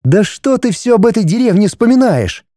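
high-pitched indignant wife. A narrator's reading. Wife tired of hearing about village, indignant. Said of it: "возмутилась Светлана".

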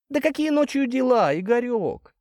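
high-pitched mother voice, protesting; doubtful mother. A narrator's reading. Mother objecting to her son's night errands, doubtful. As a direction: question him in a very high, doubting voice. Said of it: "засомневалась мать".

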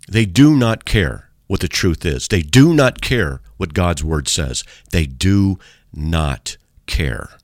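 slow, word-by-word emphasis on "do not care"; emphatic insistence. Real, not acted. Preacher lamenting indifference to the truth, emphatic insistence. Preacher hammering his point home.

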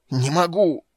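high, loud strained protest; anguished, urgent shout; desperate cry. A narrator's reading. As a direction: cry out at the end of strength. Cannot find footing while hanging.

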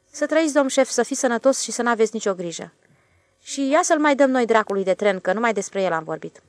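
a plain railway man shrugging off worry; switchman's voice. A narrator's reading. The switchman reassures the stationmaster, lightly voiced.

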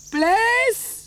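loud, high, carrying call across a distance. Acted. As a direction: shout for the lost boy, stretching the last words. Calling out for missing boy.

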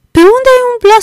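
very high squawking parrot imitation. Scrooge mimicking the parrot calling Robinson Crusoe, squawky parrot. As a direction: screech it like a talking parrot. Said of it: "așa i-a spus".

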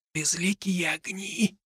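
slow, awed whisper; hushed whispered question; almost soundless whisper. A narrator's reading. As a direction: breathe the question out slowly, no voice. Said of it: "произнёс это совсем негромко, почти беззвучно".

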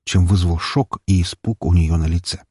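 ordinary delivery, nothing marked

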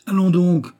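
grogné, incrédule, plus haut et plus fort; le vieux qui n'en croit rien; grognement incrédule. A narrator's reading. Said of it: "grogna le bonhomme, d'un air incrédule". (Noël grogne d'incrédulité devant Raymonde)

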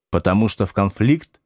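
ordinary delivery

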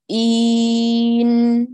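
إين held very slowly, loud then fading away; gradual fade. Shown as a demonstration. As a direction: stretch إين out and let it die down. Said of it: "بالتلاشي التدريجي".

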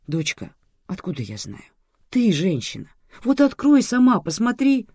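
high, loud exclamation; exasperated man telling her off; exclaiming. A narrator's reading. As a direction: protest loudly, pitch leaping up. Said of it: "воскликнул мужчина".

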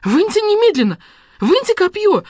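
very high, loud frantic shriek; shrieking, panicked command; hysterical. A narrator's reading. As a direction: scream the demand in hysterics. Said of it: "зашлась в истерике Василиса".

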